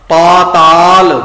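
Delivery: ਪਾਤਾਲ slow and loud, paa-taal; long first vowel. Shown as a demonstration. Teacher models the right reading, ਪਾਤਾਲ with long aa. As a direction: stretch both aa vowels in ਪਾਤਾਲ. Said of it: "ਪਤਾਲ ਪਾਠ ਨਹੀਂ ਕਰਨਾ".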